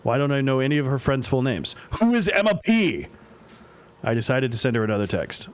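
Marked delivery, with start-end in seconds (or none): 2.0-3.1 a worried dad suddenly stumped; baffled panic. Acted. Worried dad baffled by his daughter's friends, alarmed disbelief.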